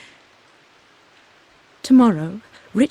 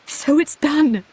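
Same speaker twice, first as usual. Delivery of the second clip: a happy laugh into the words, pitched up and slow; little laugh; 8 semitones higher, slower than usual. Acted. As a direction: laugh it out, giddy with relief. Laughing after deciding to marry.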